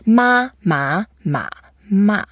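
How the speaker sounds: four "ma"s: level, rising, dipping, falling; four tones in sequence. Shown as a demonstration. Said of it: "when you have four tones".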